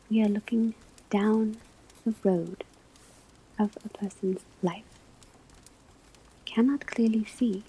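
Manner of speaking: ordinary delivery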